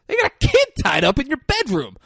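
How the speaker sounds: very high, urgent mock call; alarmed retelling. Film character telling Chucky about the tied-up kid, high mock alarm. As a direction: call out the news in a high acted voice.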